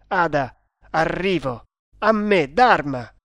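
urgent heroic shout; rallying call. Acted. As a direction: summon your companion to the attack. Hero calls out, rushing to rescue.

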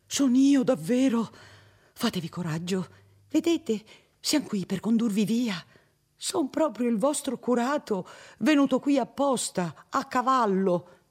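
high, fussy reassuring priest voice; nervous old priest. Acted. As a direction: soothe her, flustered and insistent. Narrator voices Don Abbondio.

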